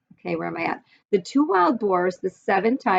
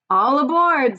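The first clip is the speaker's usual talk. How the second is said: lightly voiced dragon calling out, higher and louder; excited baby dragon; 6 semitones higher, 5 dB louder than usual. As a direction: call it out like an eager young dragon.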